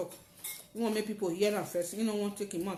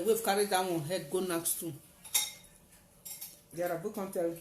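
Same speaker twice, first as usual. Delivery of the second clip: ordinary talk, nothing marked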